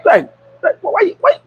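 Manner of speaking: high-pitched mock mother; imitating the mother. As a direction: whine his name like a scolding mom. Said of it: "And so the mother go".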